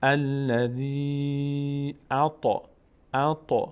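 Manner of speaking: slow الذی with a long held i; drawn-out recitation. Shown as a demonstration. Teacher recites the verse piece, drawn-out madd. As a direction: hold the end of الذی, then recite اعطی. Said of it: "باید بکشیم یا رو".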